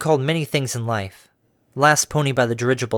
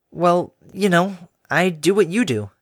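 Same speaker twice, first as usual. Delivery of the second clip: evasive; 5 semitones higher, slower than usual. A narrator's reading. Harmony evasive.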